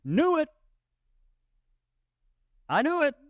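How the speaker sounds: hammed-up high "knew it"; mock triumphant. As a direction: crow it like you caught someone out. Mocking reaction to labelling God.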